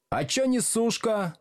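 high-pitched mock shout; heckling call. A narrator's reading. Pupils heckling the teacher from the back desks, high shout. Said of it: "крикнули с задних парт".

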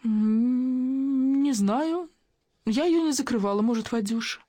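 high-pitched, drawn-out uncertainty; hesitant and thin, pitched well up; faint, fearful murmur. A narrator's reading. Actress slowly grasping the door question, high faint murmur. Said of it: "наконец, прошептала".

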